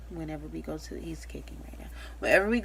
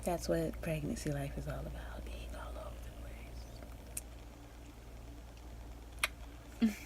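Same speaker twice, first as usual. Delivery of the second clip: softer, confiding aside; whisper; 11 dB quieter than usual. Real, not acted. Mom whispering to viewers that pregnancy is messy. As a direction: whisper it as a joke.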